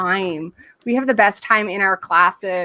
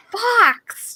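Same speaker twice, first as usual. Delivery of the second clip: excited teacher; 12 semitones higher than usual. Shown as a demonstration. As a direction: call out colours to thrilled toddlers. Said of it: "get really excited with the little kids".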